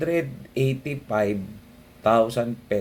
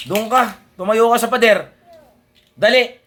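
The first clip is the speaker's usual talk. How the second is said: loud fatherly commands; calling out; 11 semitones higher, 9 dB louder than usual. Real, not acted. Father directs child loudly.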